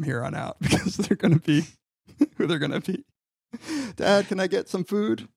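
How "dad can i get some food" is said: lightly voiced child, raised pitch; a hint of a pleading kid; child asking dad. Kid asking dad for food, lightly voiced child.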